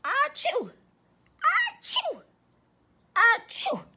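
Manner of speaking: high, loud fake sneezes; three sneezes. Pigs catching the cold, sneezing in turn. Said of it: "sneezed all three little pigs".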